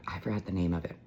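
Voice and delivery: normal talking voice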